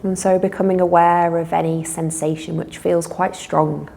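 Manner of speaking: calm guided relaxation with long pauses; guided meditation. Real, not acted. Teacher leading listeners in a body-awareness relaxation, unhurried meditation voice. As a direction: guide them gently through noticing breath and body.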